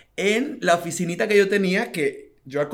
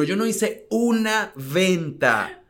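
ordinary talk

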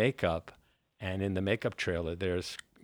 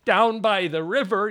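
Chris Farley shout; 10 semitones higher, 9 dB louder than usual. Retold or imitated. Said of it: "Chris Farley catchphrase".

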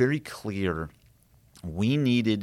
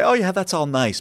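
high, louder breezy mock dismissal; dismissive brush-off; 11 semitones higher, 7 dB louder than usual. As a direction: wave the question aside lightly. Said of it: "and he would say".

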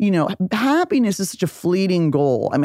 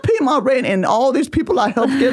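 higher, hammed-up sweet request; mock pleading; 7 semitones higher than usual. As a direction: sweetly ask to pay rent with good deeds. Mock asking landlord for rent.

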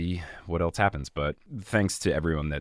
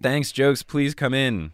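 loud, higher playful welcome; silly welcome; 8 semitones higher, 6 dB louder, slower than usual. Pretend. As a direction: call in the pretend guest. Welcoming imaginary guest Jokes.

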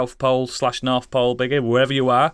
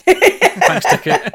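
squeaky high mock; 7 dB louder, much slower than usual. Mocking echo of name.

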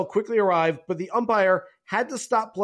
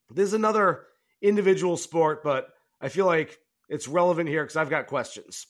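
ordinary talk

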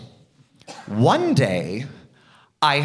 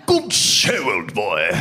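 hammed-up upper-crust British accent; posh Queen's English; 5 semitones lower, much slower than usual. Comic mimics how Brits supposedly talk, posh Queen's English. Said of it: "all British people spoke like the Queen".

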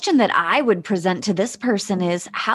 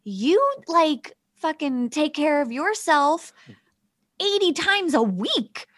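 exasperated retort; 7 semitones higher, slower than usual. Retold or imitated. Retelling argument with husband.

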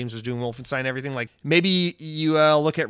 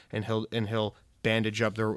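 ordinary talk

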